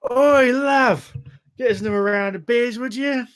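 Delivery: loud, high Cockney call across a pub; rowdy Cockney pub patron calling to the barmaid; Cockney accent. Acted. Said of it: "always have a fucking Cockney accent".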